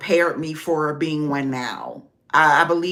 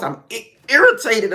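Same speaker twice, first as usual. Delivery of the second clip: normal talking voice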